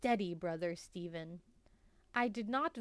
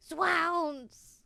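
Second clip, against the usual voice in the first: dramatic exclamation; 8 semitones higher, 7 dB louder than usual. Acted. Exclamation of horror.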